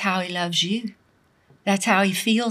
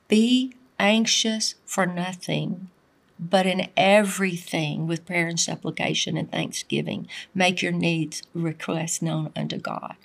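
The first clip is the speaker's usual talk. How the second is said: hushed scripture reading; 5 semitones lower, 6 dB quieter than usual. Acted. Scripture read hushed and slow.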